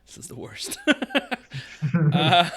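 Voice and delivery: whispered through laughter; breathless laugh. Real, not acted. Laughing at the name.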